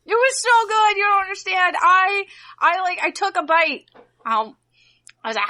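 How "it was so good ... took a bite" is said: high, emotional plea; tearful insistence. Real, not acted. Defending the emotion to co-host, tearful high insistence. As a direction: insist through rising tears, high and choked. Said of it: "I'm gonna cry now".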